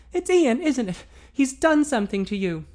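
put-on worried young woman. A narrator's reading. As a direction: blurt out the fear. Female character voiced.

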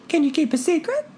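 put-on high girlish voice, conspiratorial; daughter's secret. A narrator's reading. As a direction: let him in on a secret.

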